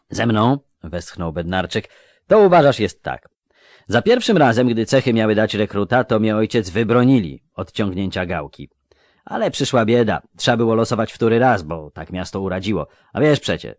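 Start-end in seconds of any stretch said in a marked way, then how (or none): 0.1-0.6 eager country lad voice; a raised, lively village boy; rustic young recruit. Acted. Melfi sighs his story. 2.3-13.7 eager country lad voice; a raised, lively village boy; rustic young recruit. Acted. Melfi sighs his story.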